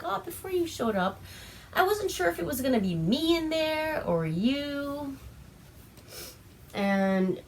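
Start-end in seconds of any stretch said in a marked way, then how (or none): 0.0-5.2 mock creepy cheer. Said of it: "And he's like".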